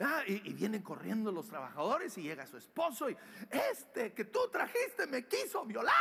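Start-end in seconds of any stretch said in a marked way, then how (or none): 3.5-5.9 high, hammed-up outraged wife; shouted accusation. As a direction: accuse him in a raised, high voice. Wife accuses José to husband.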